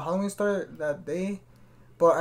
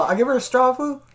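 normal talking voice

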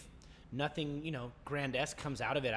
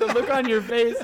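high laughing; 9 semitones higher, 14 dB louder than usual. Real, not acted. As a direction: talk while laughing hard. Laughing at friend.

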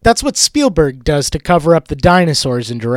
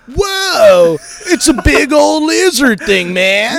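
higher, awestruck surfer dude voice; mock surfer; 8 semitones higher than usual. Turtle sees Godzilla in the sewer, as an awestruck mock surfer. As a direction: gape at it like a stoner.

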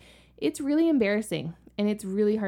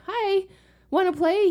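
put-on high, louder chirpy kid hello; polite little kid greeting; 12 semitones higher, 6 dB louder than usual. Parent voicing how a normal kid says hi, high and chirpy. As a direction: greet a new playmate politely and brightly. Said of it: "instead of going up to them and saying".

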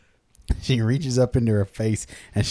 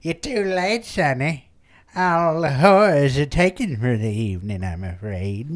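put-on old madam; 4 dB quieter than usual. Old madam turning away a late customer, in an old-woman voice. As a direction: creak it out like an old brothel keeper. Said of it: "the madam's there and she's all old".

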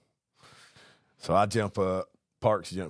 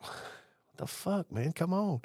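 high-pitched, under-the-breath impatience; exasperated mock plea; 8 semitones higher, 5 dB quieter than usual. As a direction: complain softly, pitched right up. Impatient at slow tenant.

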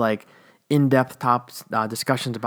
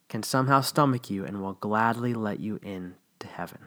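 whisper; 5 semitones lower, 6 dB quieter than usual. Real, not acted. Sarcastic aside on God's mercy.

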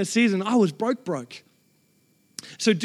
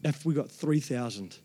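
hushed daydream; 6 semitones lower, 5 dB quieter than usual. Retold or imitated. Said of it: "I'd been daydreaming".

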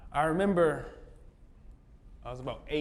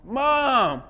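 loud, high panicked yell for mom; scared child calling mom; 9 semitones higher, 7 dB louder than usual. Retold or imitated. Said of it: "I went to yelling".

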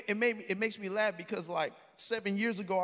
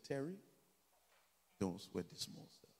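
much lower and much softer, unbothered; quiet calm mother; 8 semitones lower, 11 dB quieter than usual. Retold or imitated. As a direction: hush him gently like Mom. Mother calmly answers his panic.

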